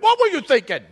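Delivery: high, loud exasperated yell with a laugh; hammed-up outrage at his sister; mock outrage. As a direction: son yelling at sister over wrecked car, mock furious. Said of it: "dual personality thing".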